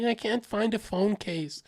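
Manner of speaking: mock a girl whining about a trivial problem. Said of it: "She's like".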